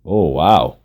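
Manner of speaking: put-on low, swooping Russian-accented wonder; amazed Russian accent. A narrator's reading. Masseuse amazed by military soap, Russian-accented exclamation. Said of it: "she exclaimed, amazed".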